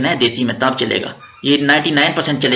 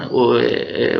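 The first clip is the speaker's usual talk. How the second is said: ordinary talk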